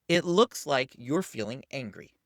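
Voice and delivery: slow, deliberate naming again. Shown as a demonstration. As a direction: keep it slow and steady. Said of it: "simply say this".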